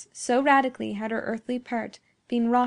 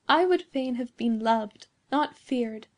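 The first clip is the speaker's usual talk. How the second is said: ordinary delivery, nothing marked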